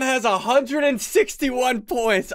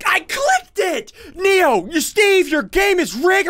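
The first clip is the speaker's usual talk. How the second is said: outraged shout; 7 semitones higher than usual. Real, not acted. Outraged outburst at mistake.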